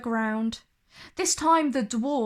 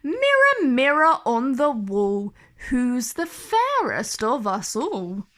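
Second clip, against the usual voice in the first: haughty queen; 5 dB louder than usual. Acted. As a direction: command the mirror. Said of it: "strode up to her mirror".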